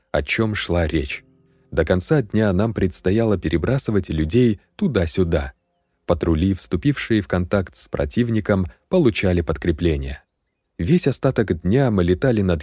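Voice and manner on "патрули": ordinary delivery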